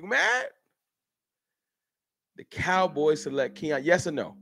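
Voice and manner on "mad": high teasing rise on mad; sing-song you mad, pitched way up; mocking taunt. Shown as a demonstration. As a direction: poke fun at the doubters. Taunting chat.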